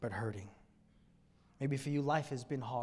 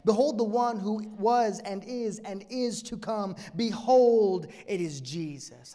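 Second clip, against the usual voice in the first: rising, louder behold refrain; preaching at full force; 6 semitones higher, 6 dB louder than usual. Acted. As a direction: build each behold higher to a climax.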